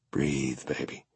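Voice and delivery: murmured close to her ear, very soft. A narrator's reading. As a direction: hush her gently, barely voiced. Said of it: "I murmur in her ear".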